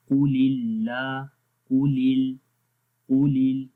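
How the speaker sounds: قُلِ with a thick قا, then a thin ال; tajweed demo of قُلِ ال; heavy qaf, light lam. Shown as a demonstration. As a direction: stress the heavy قُ, keep ال light. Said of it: "پہلے قاف موٹا پڑھیں گے، آگے باریک".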